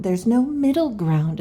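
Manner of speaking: usual speaking voice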